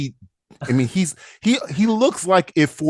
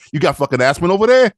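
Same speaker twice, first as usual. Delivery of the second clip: high street-guy accent; put-on street accent, pitched up; 4 semitones higher, 4 dB louder than usual. Shown as a demonstration. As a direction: demand it like a streetwise tough. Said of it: "sounds like you're from the street".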